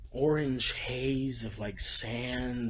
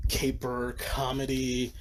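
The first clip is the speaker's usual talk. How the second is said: ordinary delivery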